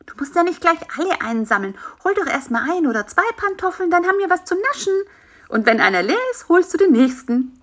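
a cheeky fly coaxing the spider to share sweets; lightly voiced fly pushing for a treat; Bisi the fly. Narrator voicing Bisi the fly.

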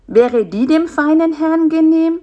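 high, loud, sarcastic politeness; mock-deferential. Spider offers a fig to the picky fly, sarcastic. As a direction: serve it with exaggerated deference.

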